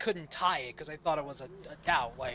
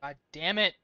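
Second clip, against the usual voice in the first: frustrated curse; 6 dB louder than usual. Real, not acted. Streamer frustrated at game.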